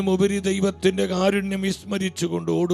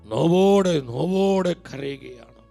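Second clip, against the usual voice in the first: mournful lament; 5 semitones lower, slower than usual. Real, not acted. Lament over mother's pain.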